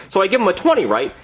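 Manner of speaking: ordinary talk, nothing marked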